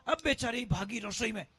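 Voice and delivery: usual speaking voice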